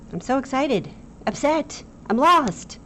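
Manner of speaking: high, loud mock despair; hammed-up distress. Shown as a demonstration. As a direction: overact the strong feeling. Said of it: "I might have overacted it".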